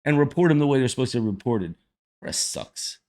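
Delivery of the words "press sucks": under-the-breath whispered jab; muttered aside. Real, not acted. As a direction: toss it off in a whisper. Muttered aside after rant.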